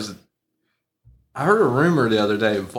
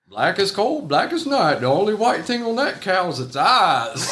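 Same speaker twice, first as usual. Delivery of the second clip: higher-pitched Southern farmer drawing out a punchline; Southern country accent; 7 semitones higher than usual. Retold or imitated. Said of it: "talking with a great Southern country accent".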